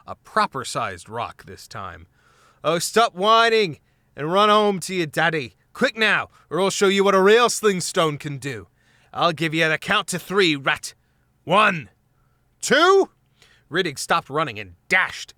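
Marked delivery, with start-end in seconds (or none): 2.6-13.1 loud mocking threat; threatening taunt. A narrator's reading. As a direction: count him down menacingly. Gonf threatening with sling.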